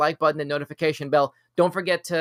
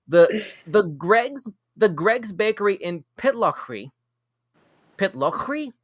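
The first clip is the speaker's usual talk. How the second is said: put-on higher, amateur Scottish accent; attempted Scottish accent; 5 semitones higher, slower than usual. Host reading a bakery story in an attempted Scottish accent. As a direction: have a go at your best Scottish accent. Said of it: "That's my best Scottish accent right now".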